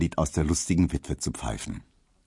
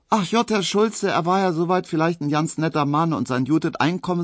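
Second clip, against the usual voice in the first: high Berlin maid voice, chattering; gossipy maid; 9 semitones higher than usual. Acted. Maid chatters to the postman about her ex, high-pitched Berlin voice. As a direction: dish the gossip with relish.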